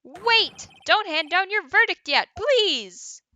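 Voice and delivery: high, loud pleading shout; desperate objection. Acted. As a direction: shout to stop the verdict, begging. Acted courtroom objection before verdict.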